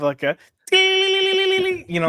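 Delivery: high, slow sing-song tiptoe sound; a high drawn-out cartoon effect; mock sound effect. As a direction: hum it like an old cartoon tiptoe. Imitating old cartoon sound.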